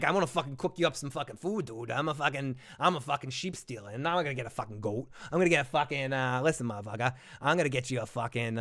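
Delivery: hammed-up Boston dude, sweary and casual; Boston accent. Sheep Stealer offers Rhaena food, mock Boston accent. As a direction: play the dragon as a Boston townie. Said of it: "put on my Boston accent".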